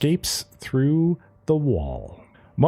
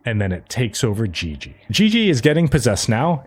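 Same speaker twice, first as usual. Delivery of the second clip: ordinary talk, nothing marked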